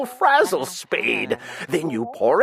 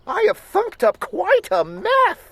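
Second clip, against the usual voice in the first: put-on high, alarmed girl's voice; a frightened little cry; dismayed; 13 semitones higher than usual. A narrator's reading. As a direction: sister realizing her mistake, high and dismayed. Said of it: "gasped my sister".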